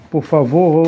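ordinary talk

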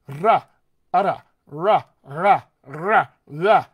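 change the tongue and lip shape on every "ra"; varied R sounds. Shown as a demonstration. Coach shows many ways to make R, punchy "ra" takes. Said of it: "seven different physical things".

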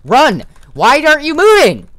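high shrieking yells; panicked screaming. Real, not acted. Player unable to move as monster attacks, screaming. Caught again, can't move.

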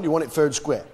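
ordinary talk, nothing marked

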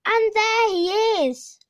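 high and loud booming roar; loud roaring giant chant. A narrator's reading. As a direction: bellow the chant like an angry giant. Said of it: "roared the giant".

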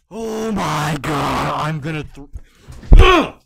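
ordinary delivery

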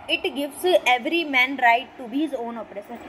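each word laid out very slowly; slow quote reading. Shown as a demonstration. Teacher to students, reading a quote very slowly. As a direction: read the quote slowly and deliberately for the class.

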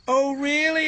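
high-pitched teasing exclamation; exclaimed surprise. A narrator's reading. Percy teases Thomas about the dark, exclaimed surprise. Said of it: "exclaimed Percy".

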